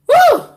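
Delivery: shrill-high exhale after laughing; high loud whew. Real, not acted. Woman to viewers, high loud whew after laughing.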